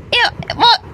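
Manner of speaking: ordinary delivery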